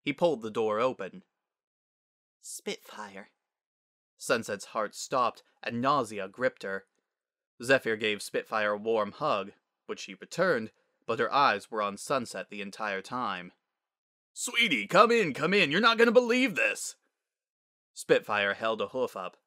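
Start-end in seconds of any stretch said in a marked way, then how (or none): 2.4-3.3 hushed name. Acted. Father opens the door to Spitfire, hushed. Sunset dreads seeing Spitfire. 14.3-17.0 high, loud, gushing welcome; excited father. Acted. Father welcomes his daughter at the door, excited.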